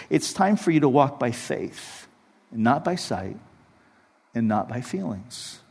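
hushed divine whisper to the heart; God speaking gently. Retold or imitated. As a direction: speak it as a quiet inner voice. God's voice spoken to his heart.

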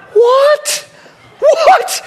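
high loud laughing what; disbelief. Retold or imitated. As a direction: squeal it in shock. Learning his assistant did it.

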